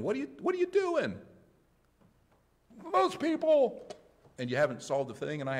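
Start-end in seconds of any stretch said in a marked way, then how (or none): 0.0-1.2 very high-pitched mock wife voice; alarmed wife. Wife bursting in on husband yelling at the TV, high-pitched alarm. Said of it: "your wife runs in". 2.9-3.9 loud, very high mock scream. TV viewer answering wife about the evening news, mock screaming. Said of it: "you just start screaming at the T V".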